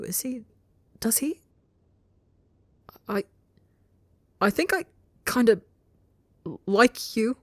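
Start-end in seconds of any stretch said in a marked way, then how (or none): 3.1-7.4 a high, hesitant confession that lifts up; like a question. Acted. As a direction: ask it more than say it, much higher. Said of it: "says it like it's a question".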